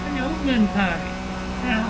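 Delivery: high pet-talk voice, cooing; cooing at the cat. Real, not acted. Cat owner greeting his cat Moon Pie in a high coo.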